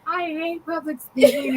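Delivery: high, slow mock whine; drawn-out teary imitation; imitated tearful complaint. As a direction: mimic someone complaining through tears. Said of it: "she's over here in tears".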